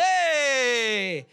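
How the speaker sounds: loud, high, long-held holler; mock Italian outburst. Wife turned Italian at a driver, mock indignant holler. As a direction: holler it like a hot-tempered Italian. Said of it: "She turned Italian all of a sudden".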